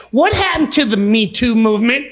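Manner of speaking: ordinary talk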